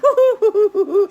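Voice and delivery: high, loud woo-hoos; string of high-pitched excited hoots; gleeful whooping. Acted. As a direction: whoop it up with glee. Story characters having a good time.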